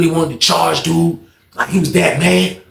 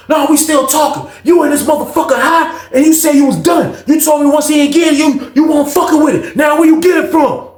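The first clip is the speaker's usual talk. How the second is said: high-pitched heated tirade; retold argument, pitched up and pressing; snapping; 9 semitones higher than usual. Rob confronting Skate over the drugs, snapping angrily. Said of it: "Rob and that junk snapping".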